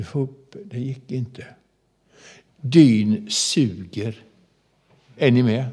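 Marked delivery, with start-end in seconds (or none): 2.6-4.2 ominous slow. Acted. Dramatic pause about the mud.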